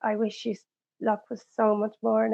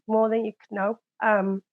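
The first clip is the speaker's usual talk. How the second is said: ordinary talk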